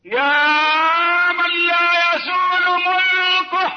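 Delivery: solemn supplication; earnest prayer. Acted. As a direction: plead to God, lingering on each phrase. Closing supplication to God.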